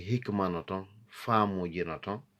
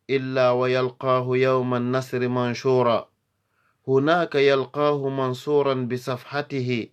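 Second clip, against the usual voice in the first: loud, high, brisk verse delivery; Arabic poem recitation; 5 semitones higher, 6 dB louder, faster than usual. Acted. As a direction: declaim each verse high and quick, pausing between lines.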